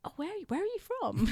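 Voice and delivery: high, softly hesitant question; puzzled parent. Retold or imitated. Parents asking the party princess aside, puzzled. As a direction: imitate a parent tentatively puzzling over an accent.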